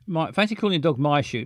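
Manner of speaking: ordinary talk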